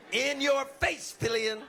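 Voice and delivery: gloating. Real, not acted. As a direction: rub it in his face. Gloating at won bid.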